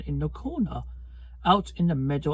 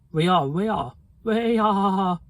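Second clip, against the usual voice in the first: louder, higher chanted chorus; 5 semitones higher, 6 dB louder than usual. A narrator's reading. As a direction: chant it out rising. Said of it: "chorused the flock".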